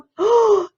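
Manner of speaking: high, sharp storybook gasp; theatrical intake of breath; excited gasp. A narrator's reading. Burglars excited nearing their goal.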